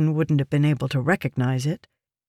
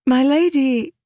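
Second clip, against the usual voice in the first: pleading protest; 10 semitones higher, 4 dB louder than usual. A narrator's reading. Protesting to her lady.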